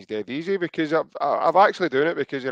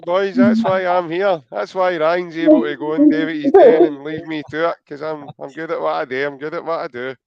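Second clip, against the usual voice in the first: higher, louder, puffed-up boasting; mock boastful; 4 semitones higher, 6 dB louder than usual. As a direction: brag like the gaffer in charge. Boasting jokingly about being gaffer.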